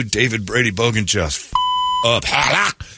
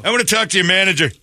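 entitled customer; 7 semitones higher, faster than usual. Acted. Demands manager.

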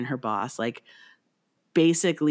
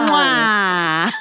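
big smacking kiss, louder and higher; loud air kiss; 3 semitones higher, 6 dB louder than usual. Acted. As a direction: blow a loud, playful kiss. Kiss sign-off in tandem.

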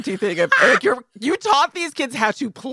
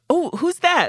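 curious little niece; 11 semitones higher, slower than usual. Retold or imitated. Niece asking about photo.

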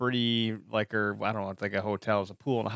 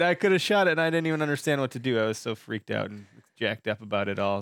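usual speaking voice

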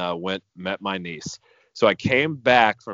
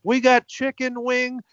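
much higher, slow, put-on mocking vet voice; 5 dB louder, slower than usual. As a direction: squeak out the doctor's line slowly, poking fun. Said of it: "as the doctor came in".

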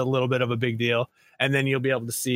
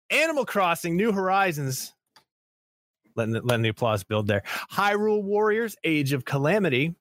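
awards announcer; 4 semitones higher than usual. Acted. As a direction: present each title like a gala host. Announcing nominees like awards show.

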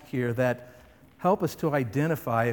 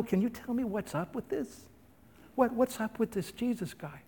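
high but soft asking; just wondering; curious girl; 7 semitones higher, 8 dB quieter than usual. Pretend. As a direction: servant girl innocently asking about Jesus. Girl's innocent question imagined.

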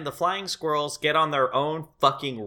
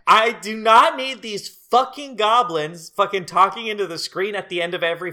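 angry rant; 6 semitones higher, 4 dB louder than usual. Real, not acted. Ranting about the movie's goblins.

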